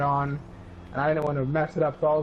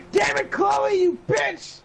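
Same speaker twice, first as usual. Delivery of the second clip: imagined yell; 14 semitones higher, much slower than usual. Retold or imitated. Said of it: "I didn't yell that, but I wanted to".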